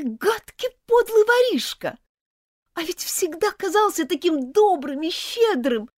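high, loud outraged accusation; angry and contemptuous. Acted. Elizabeth accuses Martin of theft, high and furious. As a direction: hurl it at him, betrayed and furious. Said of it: "с гневом и презрением".

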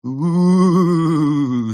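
high, loud ghostly wail; long drawn-out "oooo" howl; spooky howl. A narrator's reading. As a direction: howl like a mock demon. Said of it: "завыл он".

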